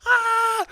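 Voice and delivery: a sudden, very high, loud squeal of delight; gleeful shriek. Real, not acted. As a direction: shriek with joy at the upset. Gloating over Notre Dame's loss.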